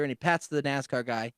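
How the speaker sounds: usual speaking voice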